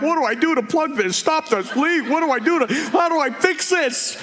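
high, frantic comic fluster; mock panic. Men flustered when the waterworks come on, mock panic. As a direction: panic upward like a man facing a leak.

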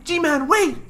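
high-pitched cartoon cry; high, urgent mock-character shout; Melman. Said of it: "trying to do my Melman voice".

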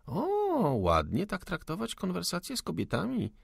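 high, teasing scolding of a lady; mock reproach. Salomea playfully scolds the soldier for his manners. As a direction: tease him for his rudeness.

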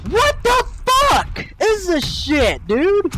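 furious disbelief. Real, not acted. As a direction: stretch every word in outrage. Raging at an online shooter.

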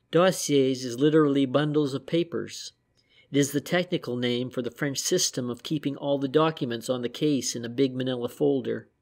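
usual speaking voice

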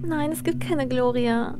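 ordinary delivery

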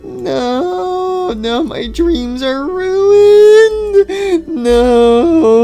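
high, slow hammed-up grief; mock lament. Toy racer mourning his ruined dreams, mock lament. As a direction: draw out the tragic loss.

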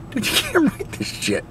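high, laughing disbelief. Real, not acted. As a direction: laugh through the words. Laughing repeat of the line.

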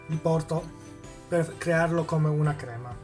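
ordinary talk

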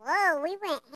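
usual speaking voice

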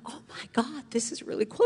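hushed awe rising to a high squeal; starstruck gushing. Retold or imitated. Kid on stage next to her idol, starstruck gushing. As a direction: start breathless and quiet, build to squeaky excitement.

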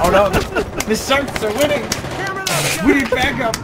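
high mock alarm; mock panic. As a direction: cry for help, hammed up. Mock gang war panic.

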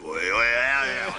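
low, rolling bear bellow; imitated bear roar. Guest acting out the bear seeing him in wrap, imitated roar. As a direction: bellow like a bear spotting its target. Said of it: "The bear saw me, went".

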